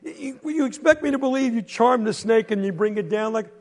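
high, loud scoffing yell; loud disbelief. Acted. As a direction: yell it's just roadkill. Quentin yells skeptically.

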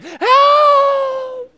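very high, loud desperate scream; screamed for help. Retold or imitated. Panicking girl screams for help, very high and loud. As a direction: shriek it like a panicking child. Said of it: "and she screamed".

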